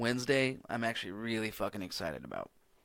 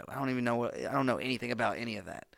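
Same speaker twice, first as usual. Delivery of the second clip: ordinary talk, nothing marked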